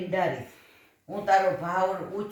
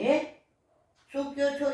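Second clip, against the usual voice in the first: shocked disbelief; 7 semitones higher, 3 dB louder than usual. A narrator's reading. Wife hears boy was beaten.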